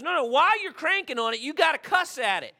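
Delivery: put-on cheeky boy letting him in on the secret; little boy voice.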